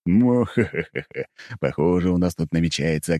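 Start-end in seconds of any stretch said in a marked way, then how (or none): 0.1-1.2 evil laugh. Acted. Villainous laugh written out.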